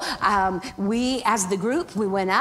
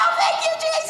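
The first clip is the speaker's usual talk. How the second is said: joyful shout; faster than usual. Retold or imitated. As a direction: shout thanks to Jesus in joy.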